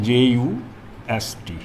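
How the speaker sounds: जे यू एस टी spelled letter by letter; spelling जे यू एस टी slowly, letter by letter. Shown as a demonstration. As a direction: spell just as जे यू एस टी, one letter at a time. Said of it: "ये बोलते हैं".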